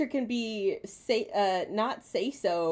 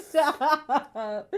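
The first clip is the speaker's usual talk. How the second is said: high squealing laughter; laughing protest; 8 semitones higher, 8 dB louder, slower than usual. Real, not acted. Being smooched, laughing loudly.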